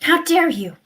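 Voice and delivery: high, outraged how dare you; indignant yell. Acted. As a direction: hurl it back at him, offended. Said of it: "Don't yell at me".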